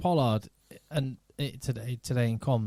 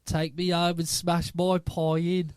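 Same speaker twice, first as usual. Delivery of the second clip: slow, put-on Birmingham accent, mildly imitated; Brummy accent; 3 semitones higher, slower than usual. Retelling a woman overheard on a park bench, slow Brummy accent. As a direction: drawl it out slowly in a light Brummy accent. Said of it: "It was a sort of Brummy accent".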